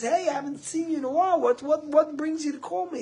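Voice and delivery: high, quick retold phone greeting; surprised greeting. Brother picks up surprise call.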